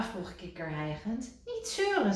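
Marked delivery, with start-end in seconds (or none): 1.4-2.1 high, curt scold. A narrator's reading. Rad to panting Kikker, high scold. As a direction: cut the whining short. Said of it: "zei Rad".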